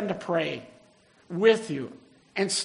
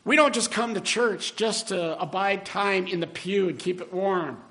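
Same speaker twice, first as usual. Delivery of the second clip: usual speaking voice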